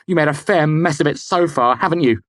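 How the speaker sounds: high, loud taunt; angry jab. A narrator's reading. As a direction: twist the knife. Kvothe continues.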